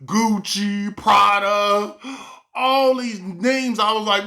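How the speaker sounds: normal talking voice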